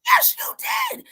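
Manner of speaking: very high, loud yelled accusation; imitating a boy's shrill furious yell; retold upset yell. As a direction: squeal it out like the angry son. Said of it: "he's getting upset".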